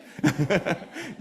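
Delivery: loud bright laugh at the punchline; hearty laugh. Real, not acted. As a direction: burst out laughing at your own joke. Preacher laughs at own joke.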